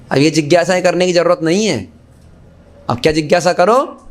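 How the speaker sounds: normal talking voice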